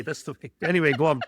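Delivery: ordinary talk, nothing marked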